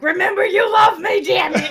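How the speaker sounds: yelled over the shoulder, loud and high; half-joking shout at the driver; playful yelled plea; mock yell. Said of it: "I'm like".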